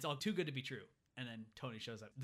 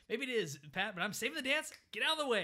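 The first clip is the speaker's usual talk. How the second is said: high, mock-heroic protest building louder; manic determination; 8 semitones higher, 5 dB louder than usual. Counselor dancing past worried Pat, hammed-up determination. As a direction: push past him with crazed zeal.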